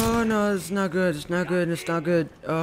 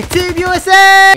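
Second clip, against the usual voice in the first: much higher, louder, slow stadium chant; full stadium cheer; drawn-out cheer; 10 semitones higher, 11 dB louder, slower than usual. Real, not acted. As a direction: belt it out, stretching every word. Repeats the chant, bigger.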